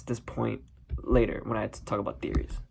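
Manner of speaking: usual speaking voice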